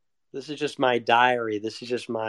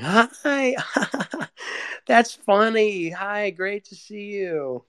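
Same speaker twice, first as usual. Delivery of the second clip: high, slow forced party cheer with fake laugh, much higher; fake cheerful greeting; 6 semitones higher, slower than usual. Arriving at the party, forced fake cheer. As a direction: overdo the polite delight. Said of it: "I go in there and I'm like".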